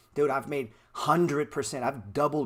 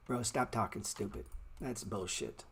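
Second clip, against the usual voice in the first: ordinary talk, nothing marked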